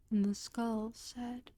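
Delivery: plain reading voice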